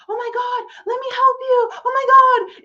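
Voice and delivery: high, gushing mock-sweet voice with a whine; mock gushing helper. Mocking online helpers as sugary saints, high and gushing. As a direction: ham up an overeager do-gooder. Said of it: "all these bitches answering, like".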